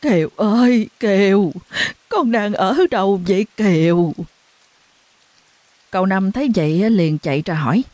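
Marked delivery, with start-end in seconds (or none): 0.0-4.1 calling out loud. A narrator's reading. Mother calling for her missing daughter, loud. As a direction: call her name into the night. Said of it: "vừa đi vừa gọi lớn".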